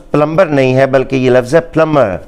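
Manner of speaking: پلمبر with a b, then پلمر with a silent b; wrong and right contrasted. Shown as a demonstration. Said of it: "بی ... mute ہوتا ہے".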